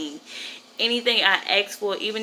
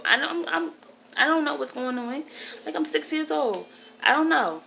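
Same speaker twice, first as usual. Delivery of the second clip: much higher voice, playing her younger self; confused six-year-old; 8 semitones higher than usual. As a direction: lift it high like a puzzled little kid. Voicing herself as six-year-old.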